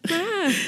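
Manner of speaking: single high startled "ah"; mock frightened exclamation; mild scared yell. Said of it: "he gets a little scared and yells".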